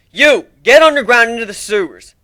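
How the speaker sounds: loud high command; loud commanding shout; barked order. Acted. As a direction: order them off sharply. Commanding ponies.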